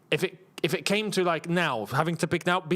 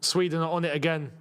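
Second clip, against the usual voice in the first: ordinary talk, nothing marked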